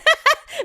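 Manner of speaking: sudden high, loud laughter. Real, not acted. Guest laughing at her own rock-bottom story, loud laugh. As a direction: burst out laughing.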